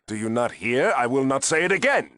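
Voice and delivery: irritated superior refusing to repeat himself; exasperated anger. Acted. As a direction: lose patience and raise your voice. Superior loses patience with repeated question.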